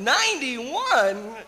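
loud, high, slow question on "Ninety-one"; astonished echo. Acted. Interviewer amazed at old puppet's age, loud astonishment. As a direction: stretch "Ninety-one" upward in disbelief.

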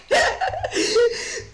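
helpless high burst of laughing; high loud laughter. Real, not acted. Streamer cracking up about the green guys, high laughter. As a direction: let the laugh rise and ring out.

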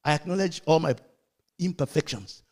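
ordinary talk, nothing marked

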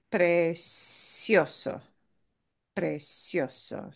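precioso slowly, weight on "cio"; slow, softening precioso with "cio" stressed. Shown as a demonstration. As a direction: draw out precioso, punching the next-to-last syllable. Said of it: "stress the second to last".

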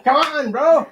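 high, loud cheering call; excited cheer. Real, not acted. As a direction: egg him on. Cheering partner game.

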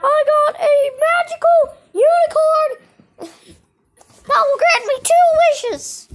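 high, loud squeal of delight; play-acted excitement. Puppeteer showing off a magical unicorn, thrilled.

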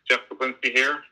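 ordinary talk

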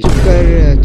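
sharp loud shut-up; loud hush. Real, not acted. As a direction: cut him off loudly. Hushing friend loudly. (ज़ोर से चुप कराना)

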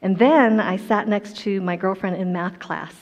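normal talking voice

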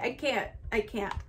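normal talking voice

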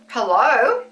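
ordinary talk, nothing marked

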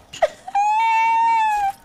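long high-pitched cry; high wailing sob. Real, not acted. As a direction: wail out in distress. Kid upset after losing match.

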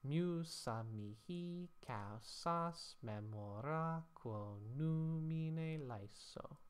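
slow, stretched chant of the Latin verse; slow scanned Latin reading; Latin verse recitation. Shown as a demonstration. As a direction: read it out like an ancient poet, keeping the beat. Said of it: "that will give us".

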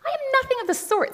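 very high, loud protest; petulant outraged girl; indignant. A narrator's reading. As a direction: stamp your foot as you deny it. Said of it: "said Jill, stamping her foot".

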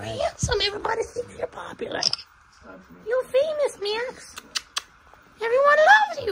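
doting praise. Real, not acted.

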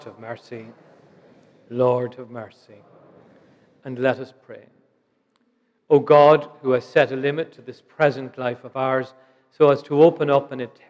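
normal talking voice

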